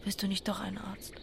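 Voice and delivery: hushed, breathy whisper. Real, not acted. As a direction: keep it secret, barely voiced. Secret whispered talk in bed.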